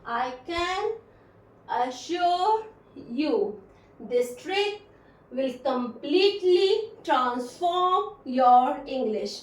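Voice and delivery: pauses after "can", "assure", "you" and "trick"; slow teacher's model, cut into small pieces; broken into chunks. Shown as a demonstration. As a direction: break the sentence into short, separated chunks. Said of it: "छोटे छोटे टुकड़ों में तोड़कर बोलना".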